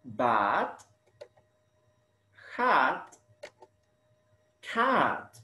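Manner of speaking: bat, hat, cat, rising on "Bat", falling on "cat"; highlighted rhyme. Shown as a demonstration. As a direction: set out "Bat", "hat", "cat" separately for learners. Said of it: "the similar sounds".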